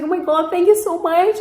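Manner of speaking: high-pitched, grateful retelling; gushing thanks. Retold or imitated. Driver thanking the girl who let her leave, gushing. Retold thanks to the rescuing stranger.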